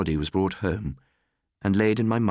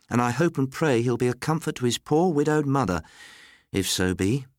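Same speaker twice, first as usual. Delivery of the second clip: lightly voiced servant, pitched up; pious Missus Ryder; 7 semitones higher than usual. A narrator's reading. Servant hopes the boy will comfort mother, high-pitched. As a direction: offer a pious wish in a higher voice.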